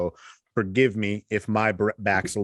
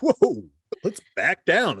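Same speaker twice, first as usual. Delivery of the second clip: mock alarmed whoa; 9 semitones higher, 4 dB louder, slower than usual. Calming the mock fight.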